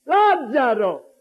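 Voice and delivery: drawn-out summons; great cry. Acted. Jesus calling into the tomb, great cry. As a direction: call the name out long and strong. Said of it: "gridò a gran voce".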